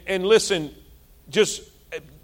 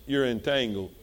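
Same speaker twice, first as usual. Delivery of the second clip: much lower and softer; quietly telling the congregation the truth; quiet verdict; 8 semitones lower, 8 dB quieter than usual. Real, not acted. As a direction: drop the voice for the verdict. Quiet verdict after question.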